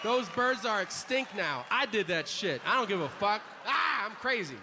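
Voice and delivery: mock macho brag. Mock bragging about killing.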